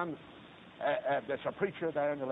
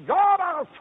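high, hollered pledge to God; impassioned pulpit shout; shouted vow; 14 semitones higher, 12 dB louder, slower than usual. Retold or imitated. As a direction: cry it out at the top of the climb. Climax of the repeated vow.

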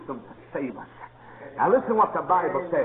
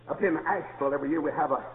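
normal talking voice

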